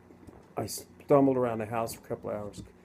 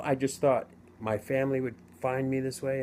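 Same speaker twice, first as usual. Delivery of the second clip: usual speaking voice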